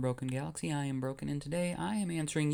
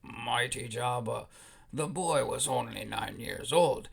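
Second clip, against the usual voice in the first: Watto; 3 semitones lower than usual. Acted. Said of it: "ends up going to the Hutts immediately and says".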